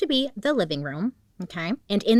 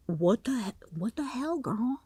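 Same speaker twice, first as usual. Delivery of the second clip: higher whispered disbelief; incredulous whisper; 5 semitones higher, 4 dB quieter than usual. Real, not acted. Reacting to the suspect's actions in a shocked whisper.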